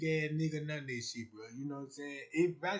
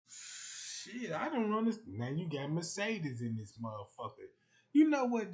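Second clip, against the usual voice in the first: ordinary delivery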